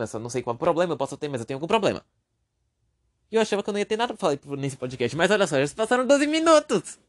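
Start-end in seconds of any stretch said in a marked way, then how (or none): 5.2-7.0 high, loud burst of surprise; amazed exclamation. Real, not acted. Podcaster noticing twelve minutes have passed, loudly surprised. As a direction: exclaim it, pitch climbing in delighted disbelief.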